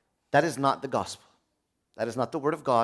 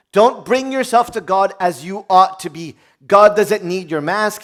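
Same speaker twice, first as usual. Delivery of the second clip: forceful preaching; 5 semitones higher, 8 dB louder, slower than usual. Acted.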